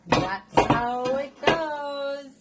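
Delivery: high, loud, drawn-out lilt; playful sing-song. Real, not acted. Playful sing-song about leftovers.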